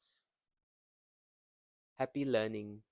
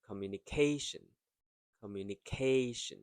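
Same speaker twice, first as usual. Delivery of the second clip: "communication" with quick opening syllables and stressed "ca"; slowed stressed syllable. Shown as a demonstration. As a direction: run up to "ca" in "communication" and lean on it. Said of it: "K slows down".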